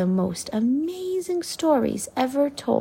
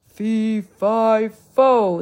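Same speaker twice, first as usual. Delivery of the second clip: slow, building louder giant chant; giant's roar; 6 dB louder, much slower than usual. Acted. The giant announces himself, growing booming roar. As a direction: boom it out like a giant. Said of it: "the giant roared".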